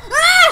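piercing, very loud scream of fright; startled scream. Real, not acted. Player caught by a jump scare, screams. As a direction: shriek as the monster jumps out. Killed by monster in game.

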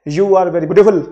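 "beautiful" blurted loud as one squashed beat; mock praise, "beautiful" run together; no pause. Shown as a demonstration. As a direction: punch out "beautiful" with no break between syllables. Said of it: "बिना pause के बोला जा सकता है".

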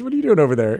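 ordinary talk

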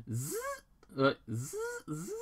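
buzzing zip sound; zipping noise. Retold or imitated. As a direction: zzz like a zipper. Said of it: "he makes a zipping noise".

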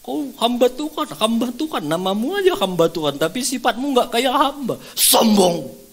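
high, mocking divine scolding; acted-out indignant rebuke; Roh Kudus. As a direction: reproach sharply, ending on a loud jab. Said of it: "kata suara itu".

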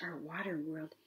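soft, low, hushed fish voice; quiet storybook creature; roundest fish. A narrator's reading. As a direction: murmur it as a gentle fish. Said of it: "said the roundest fish".

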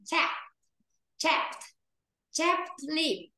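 Chapped lip with an open a, p released; model pronunciation. Shown as a demonstration. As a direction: repeat Chapped lip carefully for the class. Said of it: "mà đọc là é be này".

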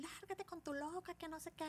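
high, soft mock scolding; squeaky-high, half-volume telling-off; angry woman. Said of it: "va la señora también".

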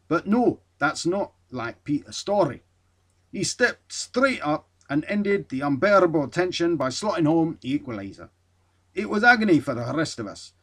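reads the skipper's words in a hammed-up Scottish accent; put-on Scottish accent. Reading the skipper's praise aloud, hammed-up Scottish accent. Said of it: "you're gonna love this accent".

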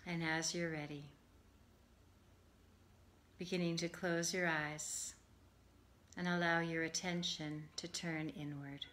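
calm, measured meditation voice with long pauses; guided meditation. Acted. Yoga teacher settling listeners for Yoga Nidra, guided meditation. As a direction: ease them gently into rest, phrase by phrase.

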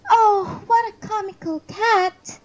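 put-on high, loud little-child voice; child piping up. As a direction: pipe it like a five-year-old. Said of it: "piped up".

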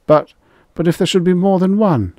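high, loud, stammered fright; panicked gulping. A narrator's reading. Rye facing being used as bait, panicked. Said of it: "Rye gulped for air".

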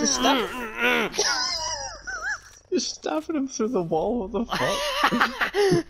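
high squealing laugh through words; laughing disbelief. Real, not acted.

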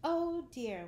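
put-on a girl flattered and flustered; fluttered oh dear. Wendy touched and flustered.

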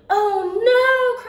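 high, loud "oh no" of sudden alarm; dismayed cry. Acted. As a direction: cry out as mom remembering too late. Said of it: "cries my mom".

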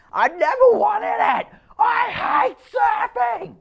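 very high, loud shriek, drawn out slowly at the end; shrieking child. A narrator's reading. Boy screaming at his father that he hates surfing, high and slow. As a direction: scream it at your dad with everything. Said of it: "heard his son shrieking".

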